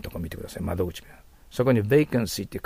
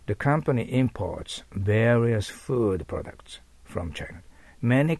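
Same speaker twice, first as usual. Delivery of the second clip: very slow English read for learners; very slow model sentence; 2 semitones higher, much slower than usual. Shown as a demonstration. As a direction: read it very slowly, each word apart.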